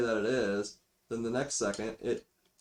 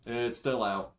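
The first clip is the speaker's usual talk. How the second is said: usual speaking voice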